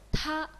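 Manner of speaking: 他 said high and level, first tone. Shown as a demonstration. Teacher modelling 他 for the student, high level tone. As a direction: hold 他 high and flat in first tone. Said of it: "the first tone is a little higher".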